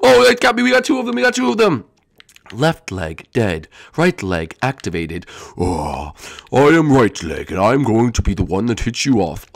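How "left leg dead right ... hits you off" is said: very low, slow announcer rumble; slow low boss announcement; deep robotic boss voice. Acted. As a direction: announce it like a machine monster.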